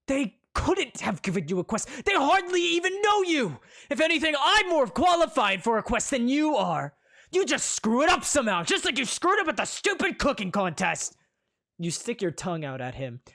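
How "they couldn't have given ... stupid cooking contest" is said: jealous rant. Acted. Jealous prince rants.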